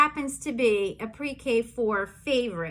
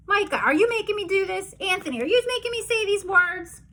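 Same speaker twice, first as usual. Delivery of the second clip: higher, fast accusing questions; mock accusing; 6 semitones higher, faster than usual. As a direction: playfully accuse the kids. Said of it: "making me say these crazy things".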